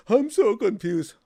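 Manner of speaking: mock-whine the word so; whiny complaint. Teacher mocking students who whine about being confused. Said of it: "They say".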